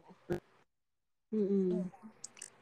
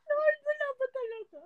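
mock despair; 13 semitones higher than usual. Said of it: "mock lament to Lord".

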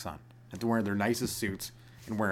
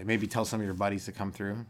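ordinary talk

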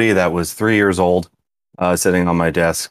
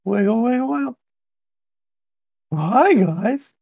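very high cartoonish greeting; playful squeaky voice; slower than usual. Co-host greeting the guys for a laugh, playful squeak. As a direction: pipe up like a tiny high-pitched toy.

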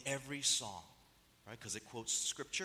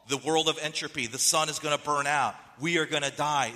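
loud, driving sermon voice; preaching at full force; 8 dB louder than usual. Acted. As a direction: preach it out to the whole hall.

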